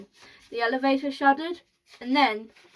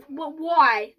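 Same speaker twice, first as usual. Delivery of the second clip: loud, higher demand; raised, baffled outcry; group shout; 4 semitones higher, 6 dB louder than usual. A narrator's reading. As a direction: shout the question in protest. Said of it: "they all shouted at once".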